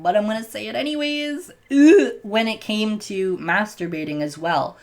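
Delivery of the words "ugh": a sudden loud, high groan of real embarrassment; embarrassed groan. Real, not acted. The vlogger braces to confess something awkward, groaning loudly. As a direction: let out a cringing "ugh" before an awkward confession. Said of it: "really awkward to talk about".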